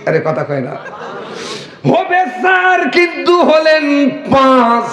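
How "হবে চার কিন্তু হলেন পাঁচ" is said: slow punchline. Acted. Punchline drawn out.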